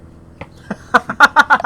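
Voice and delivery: high, loud burst of laughter. Real, not acted. Storyteller cracks up at the manager's answer, loud laugh. Reacting to his own anecdote.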